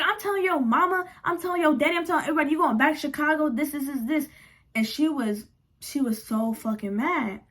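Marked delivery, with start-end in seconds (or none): 0.0-4.3 high, fast listing threats; angry threats. Retold or imitated. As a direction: rattle off threats like an angry girlfriend. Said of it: "She's like".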